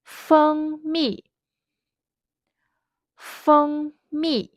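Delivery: "Feng" held high and level, "mi" falling from high; first then fourth tone. Shown as a demonstration. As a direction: hold "Feng" high, then drop "mi" sharply. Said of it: "the first tone, then fourth tone".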